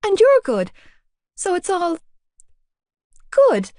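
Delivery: high, flustered babble; nervous rambling. A narrator's reading. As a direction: trip over the words. Awkward nervous girl rambling.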